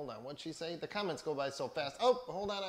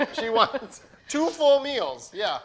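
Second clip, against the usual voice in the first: laughing emphasis; 9 semitones higher, 13 dB louder than usual. Real, not acted. Laughing retelling two meals.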